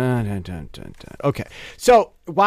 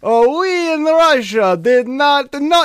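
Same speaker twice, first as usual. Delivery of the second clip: slow, higher mock Russian accent; 7 semitones higher, 5 dB louder, slower than usual. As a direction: drawl it like a Russian official. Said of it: "It's just really fun to impersonate them".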